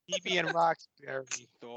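put-on thick Boston accent as Odin; a broad Boston voice. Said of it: "with the Boston accent".

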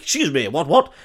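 high, lilting posh gent asking directions; hammed-up lost gentleman; posh bloke voice. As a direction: put on a fussy posh stranger. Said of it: "he's just like".

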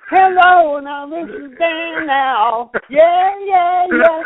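loud, high, drawn-out hammed-up greeting; imagined big-star voice. Caller imagining how the famous musician would answer, hammed up. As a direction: blare it out like an over-the-top phone persona. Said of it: "I was expecting".

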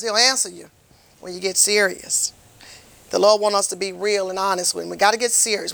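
normal talking voice

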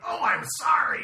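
very high, loud comic outburst; mock shouted apology. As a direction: shriek the apology like a Monty Python sketch. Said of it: "with John Cleese".

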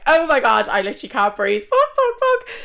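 overwhelmed squealing panic; panicked excitement. Retold or imitated. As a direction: relive the shock of seeing him, loud and frantic. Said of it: "And I'm like".

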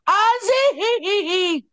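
high, long-drawn cry of a name; retold scream. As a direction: shriek the name out at length. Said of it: "Ernie screams".

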